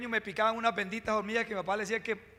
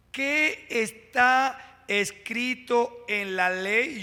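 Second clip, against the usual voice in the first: very slow, drawing out ¿Qué está escrito en la ley? with QUÉ stretched; slow emphatic repeat; much slower than usual. Shown as a demonstration. Preacher repeats the question to the church, very slow and drawn out. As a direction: let each word of ¿Qué está escrito en la ley? land slowly.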